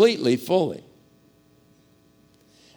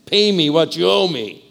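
louder and higher, grabbing him by the throat; harsh demand; 4 semitones higher, 6 dB louder than usual. Acted. Servant throttles his fellow over fifteen dollars, harsh demand. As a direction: bark it like a creditor choking a man.

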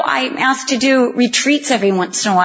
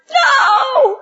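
mock protest; 10 semitones higher than usual. Protesting the potter's remaking.

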